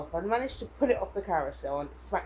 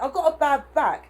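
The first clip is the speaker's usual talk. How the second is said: loud, higher angry reply; retold shout; 7 semitones higher, 9 dB louder than usual. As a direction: snap it back at him loudly. Retelling her angry reply.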